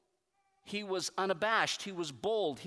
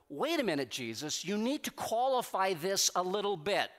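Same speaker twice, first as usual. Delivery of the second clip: louder, higher, protesting in disbelief; exasperated pleading with Jesus; 5 semitones higher, 5 dB louder than usual. Retold or imitated. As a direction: argue with Jesus, half laughing at the promise. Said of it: "I go".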